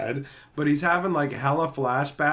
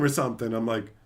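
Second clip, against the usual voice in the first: normal talking voice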